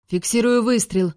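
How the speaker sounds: restrained shout from a weapons officer; calling out. A narrator's reading. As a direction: call out an urgent report to the bridge. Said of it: "выкрикнул офицер по вооружению".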